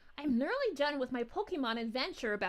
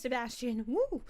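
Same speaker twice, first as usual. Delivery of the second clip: usual speaking voice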